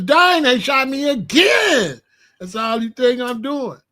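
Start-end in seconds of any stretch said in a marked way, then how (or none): 0.0-2.0 slow, loud, higher mock yell after getting shot; hollering in pain. Hollering about getting shot in a video game, slow loud yell. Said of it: "The only hollering I'm doing is".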